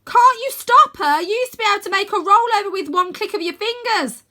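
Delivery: very loud, high pleading yell; desperate shout. Acted. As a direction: beg her across the cavern. Arthur keeps shouting.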